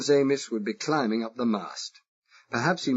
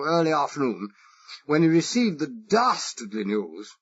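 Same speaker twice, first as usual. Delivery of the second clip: exaggerated posh English accent; 6 semitones higher, 4 dB louder than usual. Spy asking the Irish foreman, exaggerated posh English accent. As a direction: play the harmless toff with a marked English accent. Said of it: "I exaggerated it".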